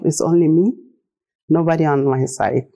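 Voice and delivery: normal talking voice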